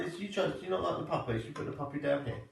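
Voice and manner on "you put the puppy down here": usual speaking voice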